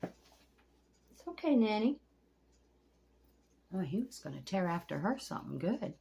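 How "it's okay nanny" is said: very slow, high cooing reassurance; soothing a pet. Real, not acted. Comforting Nanny the turtle, slow high coo. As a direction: coo it out gently to calm her.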